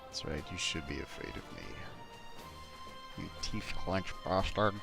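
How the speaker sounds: gruff action hero, low and quiet. Acted. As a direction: growl it like a movie hero. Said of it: "Generic action hero voice".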